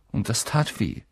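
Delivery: ordinary delivery, nothing marked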